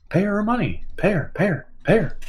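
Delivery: high, softened, stammering repeats; Jeff Goldblum. Retold or imitated. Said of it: "Doing that Jeff Goldblum".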